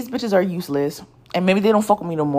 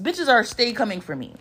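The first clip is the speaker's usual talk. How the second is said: usual speaking voice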